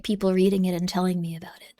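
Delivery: hushed whisper of dread. Real, not acted. Streamer whispering fear of readers reviewing the book, hushed whisper. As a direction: hide the words like a secret.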